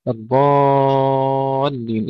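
الضالين with lengthened ض and very slow, long alif; six-count madd. Shown as a demonstration. Teacher modelling recitation, very long madd in الضالين. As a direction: stretch the aa of الضالين right out. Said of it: "استطالة الضاد ثم مد الألف مدا لازما".

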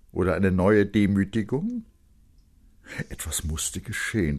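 ordinary delivery

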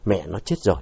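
low, softened, stricken line; understated shock in narration; voice faltering. A narrator's reading. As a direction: drop the voice as if losing it. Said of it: "giọng nó lạc đi".

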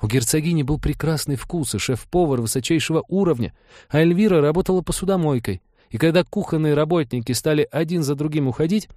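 earnest, apologetic female character; earnest Trixie. Acted. Voices Trixie explaining to guests.

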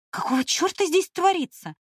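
high, outraged what-is-going-on; indignant outburst. Acted. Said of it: "возмутилась я".

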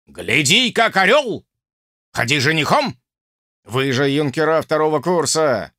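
rally the cadets with orders; stern drill sergeant. Acted. Officer lecturing senior cadets.

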